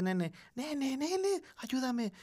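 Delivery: little brother calling out for help at night; scared little boy. Retold or imitated. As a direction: imitate a frightened kid begging for help.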